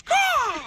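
a high, loud mock caw; imitated bird caw. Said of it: "and she goes".

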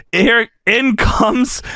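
usual speaking voice

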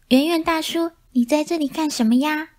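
high, lightly voiced curious mice; chirpy little mouse question. Mice ask the cat. (小老鼠好奇地问，声音尖细)

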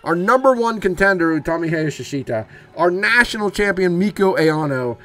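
loud, high ringside announcer call; announcer hype. Acted. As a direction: sell the names like a ring announcer. Hyped announcer calling the result.

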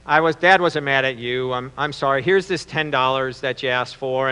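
low, soft, sincere apology; acted remorseful dad, hushed and low; apologetic father. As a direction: gently make it up to the boy. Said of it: "apologize to his son".

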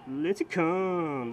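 drawn-out name. Pretend. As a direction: stretch out Lincecum like announcing him. Repeating the player's name.

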